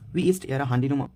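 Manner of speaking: ordinary talk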